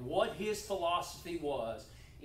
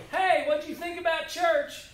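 cheery call; 11 semitones higher, 8 dB louder than usual. Shown as a demonstration. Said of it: "I go".

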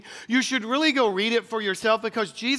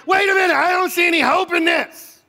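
higher and louder, mock alarm at no hope; mock panic; 6 semitones higher, 4 dB louder than usual. The optimist in him protesting, loud and alarmed. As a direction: play up the panic of finding no hope. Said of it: "I'm like".

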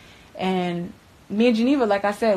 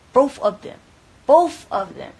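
louder, higher, each word hammered; heavy emphasis; 5 semitones higher, 8 dB louder, slower than usual. Retold or imitated. Warning him about the girl's injured eyes, with hammered emphasis. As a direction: drive home how bad it was.

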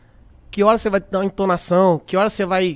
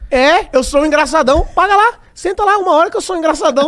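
mock boast; 8 semitones higher, 4 dB louder than usual. Mocking his own boast.

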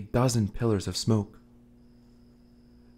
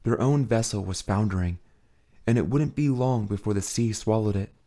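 plain reading voice

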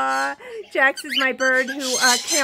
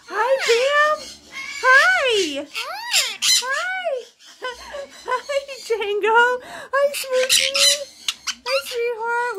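delighted pet greeting; 9 semitones higher, slower than usual. Real, not acted. Owner saying hi to her birds, delighted. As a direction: greet each pet high and gushing. Greeting each bird affectionately.

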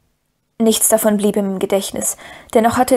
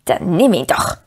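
hissing Gollum voice; 3 semitones lower than usual. A narrator's reading. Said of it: "zischte ihn die andere Stimme an".

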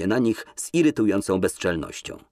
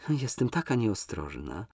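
mock contrite; 2 semitones higher, 6 dB quieter than usual. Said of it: "powiedziała jakby ze skruchą".

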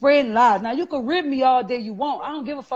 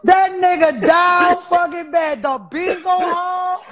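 slow, loud, drawn-out mock outrage; loud, slow retelling of the roast; mock outraged shout; 4 semitones higher, 7 dB louder, slower than usual. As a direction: stretch it out loud like you can't believe it. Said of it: "I said".

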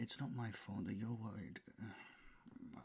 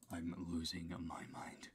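slow whisper; 3 semitones lower than usual. Real, not acted. Losing his mind at 4:57 am.

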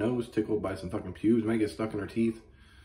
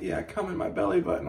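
much higher hammed-up lover's voice; mock aroused man; 12 semitones higher than usual. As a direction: camp it up as the excited guy. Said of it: "And he's like".

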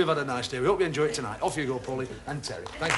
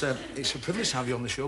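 ordinary talk

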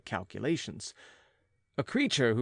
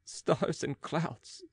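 higher, slow cry to the sky; anguished exclamation; 6 semitones higher, slower than usual. Acted. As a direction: cry out in agony to the heavens. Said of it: "I exclaimed".

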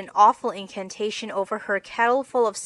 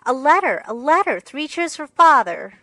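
loud, higher happy cheer; excited cry; 6 semitones higher, 9 dB louder than usual. Acted. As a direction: shout it out with joy at the news.

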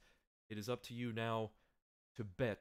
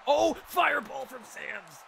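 high, slow, drawn-out commentator shout; stretched, loud play-by-play cry; excited commentary; 13 semitones higher, 9 dB louder than usual. Real, not acted. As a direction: yell the surprise attack like a match announcer. Calling a surprise attack live.

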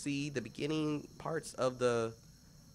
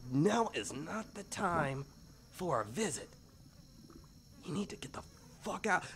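goblin hissing urgency; 7 semitones higher than usual. Acted. Goblin scolding Caleb.

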